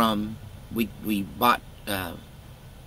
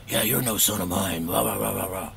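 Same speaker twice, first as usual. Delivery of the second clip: put-on grumpy old dad. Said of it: "he told me".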